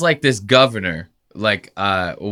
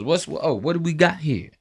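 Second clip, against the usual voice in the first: higher, softer mock musing; mock-curious, half to himself; contemplating; 5 semitones higher, 4 dB quieter than usual. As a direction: lightly imitate someone pondering a comment. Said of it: "He's, like, contemplating. He goes".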